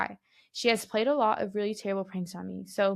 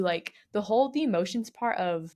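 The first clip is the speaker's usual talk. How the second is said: usual speaking voice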